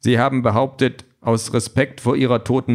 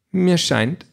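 higher-pitched, with an unruffled smile; unmoved, smiling; 7 semitones higher than usual. A narrator's reading. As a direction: smile through it, unimpressed. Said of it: "sagt Aisha ungerührt lächelnd". (ungerührt lächelnd, mit höherer Stimme)

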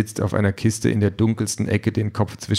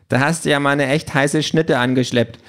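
higher, cheeky gadget voice; Quality Pad; 6 semitones higher than usual. A narrator's reading. Gadget congratulates Peter on his date, cheeky character voice. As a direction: pipe up like a cheeky device. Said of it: "sagt das Quality Pad".

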